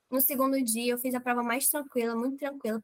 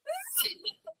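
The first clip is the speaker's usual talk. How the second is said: soft, very high squeal; gritinho agudo imitado; 8 dB quieter than usual. Retold or imitated. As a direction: let out a tiny, high mock squeal. Imita a própria reação ao resultado. (gritinho agudo e baixinho)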